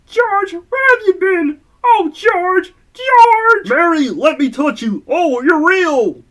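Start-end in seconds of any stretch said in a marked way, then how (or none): 0.1-3.7 higher, slower woman's voice, overjoyed; Mary's relieved greeting. Acted. Mary finding George home at last, higher relieved voice. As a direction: play Mary finding her husband safe.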